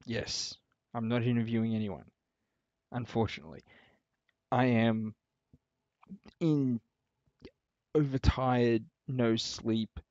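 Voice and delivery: loud, overtired hype; buzzing with tired excitement; hype mode. Real, not acted. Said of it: "hype mode".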